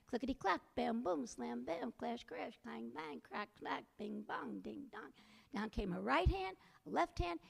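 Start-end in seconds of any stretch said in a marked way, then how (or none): none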